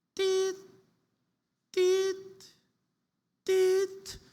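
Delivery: high, flat machine beep; steady electronic beep, high and even; heart monitor beep. Pretend. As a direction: imitate a hospital monitor beeping. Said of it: "you're in the hospital".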